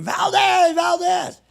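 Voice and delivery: high, loud furious yell of Valdez; screaming a name. Retold or imitated. Sergeant finding his truck empty, screaming for Valdez. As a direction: scream the name across the yard. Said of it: "he starts screaming".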